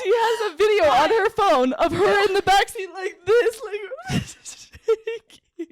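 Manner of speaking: high laughing. Real, not acted. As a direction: laugh through it. Laughing while telling.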